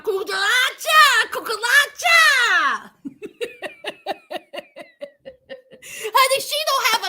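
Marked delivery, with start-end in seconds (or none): 0.0-2.9 high, loud, drawn-out party cry; mocking exuberance. Mocking the carefree fiancée. 3.0-5.1 soft, high-pitched run of laughter; high giggle. Real, not acted. Laughs at own cucaracha bit.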